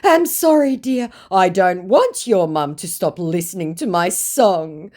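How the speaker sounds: high pleading apology; sweet-talking old crooner; simpering scammer. Retold or imitated. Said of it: "Give me one good reason".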